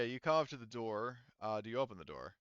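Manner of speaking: ordinary delivery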